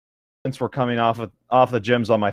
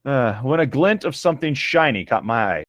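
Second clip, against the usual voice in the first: ordinary talk, nothing marked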